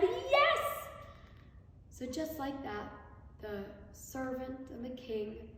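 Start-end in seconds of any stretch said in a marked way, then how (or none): 0.0-0.9 high, bright eager cry; delighted yes. A narrator's reading. King delighted to gain a servant, high excited yes. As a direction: leap at the offer. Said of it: "King Karna smiled and said".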